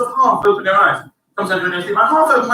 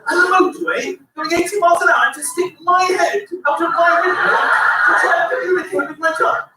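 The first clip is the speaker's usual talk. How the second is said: ordinary talk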